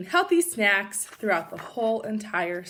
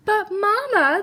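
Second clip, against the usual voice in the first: higher whiny cub protest; 12 semitones higher, 9 dB louder than usual. Acted. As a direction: plead like kids losing their snacks. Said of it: "they protested".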